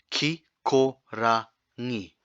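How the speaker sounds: Kikorangi said slowly, R then ng clearly; slow down through the r and ng; slow demo. Shown as a demonstration. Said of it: "next one's a little bit tricky".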